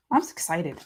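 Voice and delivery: ordinary talk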